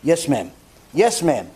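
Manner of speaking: low, polite acknowledgement; demonstrating reserved old-fashioned courtesy; cordial, respectful. Shown as a demonstration. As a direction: answer respectfully in a low, restrained voice. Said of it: "that's how we talk".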